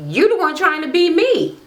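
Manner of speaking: very high-pitched sassy retort; mock accusation. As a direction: throw it back in a squeaky mock quarrel. Acting out reality-show quarrel.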